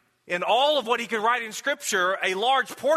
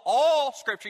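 "all" punched higher than the rest; stress on ALL; 5 semitones higher, 3 dB louder, slower than usual. Shown as a demonstration. Preacher repeating the verse to make a point, "all" emphasized. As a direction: hit "all" firmly, pitched up. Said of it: "I put the emphasis upon the word all".

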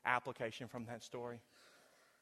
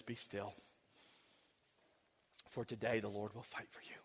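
calm reassurance; 5 semitones lower, 7 dB quieter than usual. Acted. Moses' calm word to people.

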